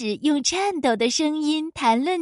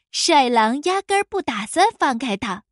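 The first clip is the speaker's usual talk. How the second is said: plain reading voice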